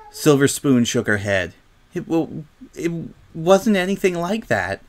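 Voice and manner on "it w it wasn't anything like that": high, halting stammer; upset denial. A narrator's reading. Silver Spoon denies a fight, haltingly upset. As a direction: struggle to get it out, pitched up. Crying girl explains lost friendship.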